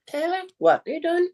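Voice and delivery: high voice as if from across the room; thrown distant voice. Shown as a demonstration. Ventriloquist demo of a wife calling, high and distant. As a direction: throw the voice so it seems far off. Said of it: "you can hear".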